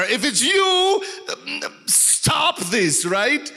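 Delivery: high eager Peter voice; excited Peter. Retold or imitated. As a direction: blurt it like a nervous fisherman. Peter's plea again.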